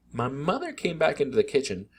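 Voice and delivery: ordinary delivery, nothing marked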